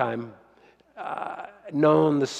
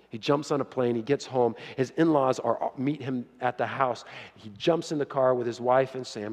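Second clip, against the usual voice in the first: ordinary delivery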